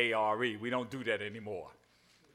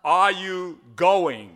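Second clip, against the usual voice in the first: slow, loud question, every word laid out; slow spelled-out question; 5 semitones higher, 13 dB louder, much slower than usual. Shown as a demonstration. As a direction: read it out slowly for the congregation to copy. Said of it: "this one here is a little tougher".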